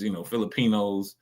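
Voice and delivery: ordinary talk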